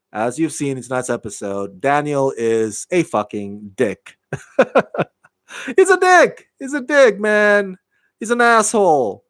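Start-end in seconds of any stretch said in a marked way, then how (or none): 4.3-9.2 high-pitched, amused exasperation; laughing. Real, not acted. Host ranting about a show character, laughing. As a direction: insult him through laughter, pitch jumping up. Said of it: "I know I'm laughing".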